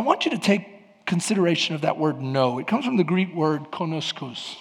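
usual speaking voice

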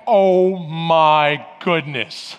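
low, drawn-out mock dismay; deadpan dread, voice dropped right down; appalled reaction. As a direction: imitate a horrified wife, slow and low at first. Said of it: "you should see my wife's reaction".

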